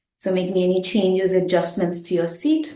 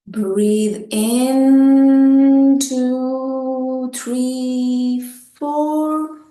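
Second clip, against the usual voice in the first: long drawn-out inhale count, rising at the end; sustained, lifted counting; breath count; 4 semitones higher, 7 dB louder, much slower than usual. Shown as a demonstration. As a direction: stretch each number to pace a slow breath in.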